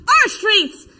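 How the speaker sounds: high, loud chant answer; yelled reply. Acted. Answering a friend's chant in the march, yelling. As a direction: yell it right back to a comrade. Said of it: "And I yelled".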